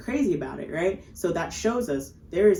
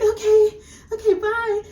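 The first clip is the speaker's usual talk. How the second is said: way higher, louder, cracking okay okay bye; panicked exit; 8 dB louder than usual. Retold or imitated. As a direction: bolt out in a squeaky panic. Fleeing after drinking venom.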